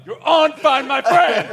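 high, slow, gleeful announcement; Uber driver blurting. Retold or imitated. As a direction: spin round and reveal it like a game show. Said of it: "the Uber driver turning around".

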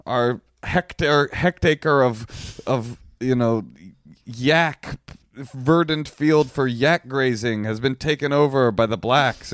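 high, slow put-on voice of an indignant bigot; mock South African Nazi. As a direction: lampoon him in a lofty, drawn-out whine. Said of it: "they're just like".

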